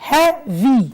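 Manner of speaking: "heavy" in two separate beats, hea, then vy; split into syllables. Shown as a demonstration. Teacher shows the adjective has two parts, saying "heavy" syllable by syllable. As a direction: break "heavy" apart between hea and vy. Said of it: "I can divide it into two".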